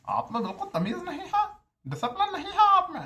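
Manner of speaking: put-on high, loud scolding in the HOD's accent, laughing through it; mocking the HOD's accent. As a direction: ham up the HOD's accent as he tells off a student. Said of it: "और यही accent में भाई". (HOD के लहजे की ऊँची, ज़ोरदार डाँट की नकल)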